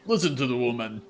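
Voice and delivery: a lightly voiced half orc teasing his friend; gruff half orc. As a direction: cheer the woman on, loudly. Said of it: "the half orc is like".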